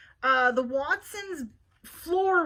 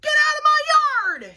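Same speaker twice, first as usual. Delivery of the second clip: angry shout; 12 dB louder, faster than usual. Acted. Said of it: "shouted Eugenia".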